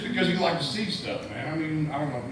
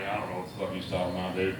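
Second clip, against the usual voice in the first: quick, lower and softer mutter; fast low aside; 10 semitones lower, 6 dB quieter, much faster than usual. Retold or imitated. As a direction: rattle it off under your breath, baffled. Narrator's baffled aside.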